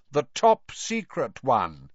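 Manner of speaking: pompous slow hint. A narrator's reading. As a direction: spell out each word to tease the listeners. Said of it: "He raised his voice slightly".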